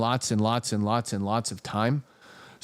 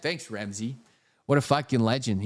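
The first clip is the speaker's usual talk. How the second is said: normal talking voice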